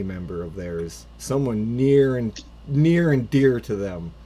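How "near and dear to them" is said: usual speaking voice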